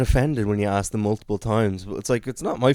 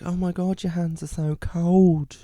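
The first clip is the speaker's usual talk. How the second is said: high-pitched, drawn-out sympathy; imitated fussing woman; 7 semitones higher than usual. Woman touching the flyerer's cold hands, high cooing pity. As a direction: coo with concern in a put-on high voice.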